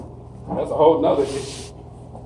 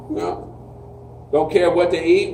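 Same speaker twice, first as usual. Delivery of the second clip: ordinary delivery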